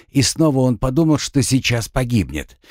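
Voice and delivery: plain reading voice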